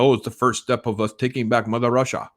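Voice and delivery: hammy, badly faked Russian accent; horrible Russian accent. Reviewer voicing Soviets plotting to take back Russia, bad Russian accent. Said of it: "That's my horrible Russian accent".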